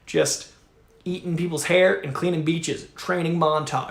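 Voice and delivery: ordinary talk, nothing marked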